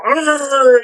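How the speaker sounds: loud, drawn-out monster groan; Frankenstein monster growl. Retold or imitated. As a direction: imitate Frankenstein's monster with a wordless grunt. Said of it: "the guy's doing the Frankenstein thing".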